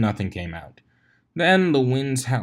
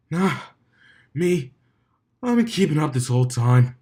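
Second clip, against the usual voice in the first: higher, strained stallion voice; feeble bravado; 5 semitones higher, 4 dB louder than usual. A narrator's reading. Dumbbell insists to Soren, feebly boasting. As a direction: brag with a weak grin. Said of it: "managed a feeble grin".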